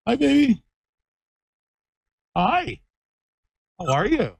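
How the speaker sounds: high, sing-song puppy talk. Real, not acted. Cooing at the puppy.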